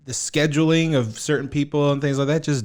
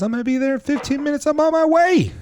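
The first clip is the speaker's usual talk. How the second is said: much higher, quick and chirpy friend's voice; mock friend's text; 13 semitones higher than usual. Retelling his late friend's text in a higher, faster voice. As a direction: play the breezy friend promising to come soon.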